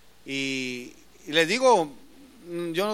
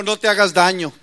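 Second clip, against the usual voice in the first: calling out to the jailer; 11 dB louder than usual. Retold or imitated. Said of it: "Pablo dijo".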